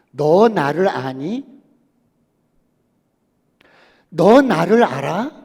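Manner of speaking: loud, slow, emphatic question; God's question thundered slowly from the pulpit; slow preached question. Acted. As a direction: hammer each word slowly to the congregation.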